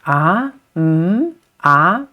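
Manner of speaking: "ah", "mm", "ah" each lifting like a question; short questioning sounds, "ah" and "mm" swinging upward; questioning rise. Shown as a demonstration. Teacher likening the tone to English questioning, rising "ah". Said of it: "It's just like a question".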